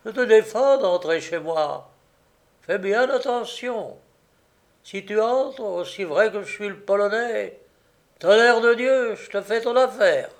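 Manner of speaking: high, furious threat building in volume; furious cry. A narrator's reading. Polish man forbidding the gamekeeper to enter, furious cry. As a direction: warn him off in a rising fury. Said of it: "gesticulant, furieux [...] il crie".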